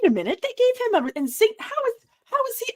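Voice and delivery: high, rushed retold puzzlement; high-pitched mock bewilderment; baffled disbelief. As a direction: reenact your brain scrambling to make sense of it. Retold baffled breakdown over the uniform.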